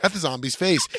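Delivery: usual speaking voice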